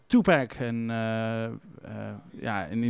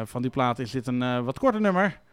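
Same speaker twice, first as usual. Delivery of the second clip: ordinary delivery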